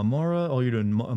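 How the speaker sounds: usual speaking voice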